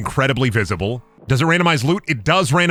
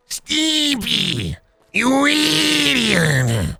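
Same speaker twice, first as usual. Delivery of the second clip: high, very stretched cartoon rant; Ren; 9 semitones higher, much slower than usual. Ren berating Stimpy, high stretched cartoon voice. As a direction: draw each word out in exasperation. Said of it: "Can you do Ren or Stimpy?".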